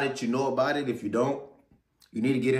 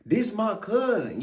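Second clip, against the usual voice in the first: excited recognition; 8 semitones higher, slower than usual. Retold or imitated. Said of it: "and I'm like".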